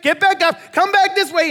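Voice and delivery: high, loud sideline yelling; father running beside the field yelling to his son, loud and high; yelling coach dad. Retold or imitated. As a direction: cheer your kid on from the touchline, full voice. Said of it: "he's yelling to his son the whole way".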